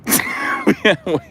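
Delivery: short high-pitched giggle. Real, not acted. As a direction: break into a giggle mid-rant. Laughs at own joke.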